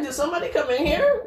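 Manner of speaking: high, quick mock alarm; startled awake. Snorer reenacting waking to her own noise, mock startled. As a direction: imitate a confused sleeper, laughing through it. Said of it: "I'd wake up like".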